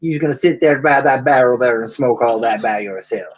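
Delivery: hammed-up Southern cowboy scolding; attempted Southern drawl. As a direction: play a Southern old-west type with a drawl. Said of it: "my attempt at a Southern draw".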